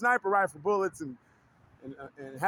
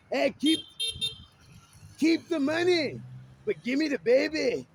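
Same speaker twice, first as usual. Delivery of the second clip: Fat Bastard; 4 semitones higher than usual. Retold or imitated. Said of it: "Fat Bastard".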